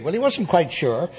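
normal talking voice

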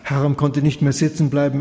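ordinary delivery, nothing marked

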